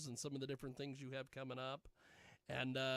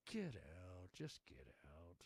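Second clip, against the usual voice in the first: much lower, softer put-on voice; low mock command; 8 semitones lower, 8 dB quieter, slower than usual. Host playing with a saying, in a low hushed voice. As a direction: drop deep and quiet to order someone out. Low acted quote after louder line.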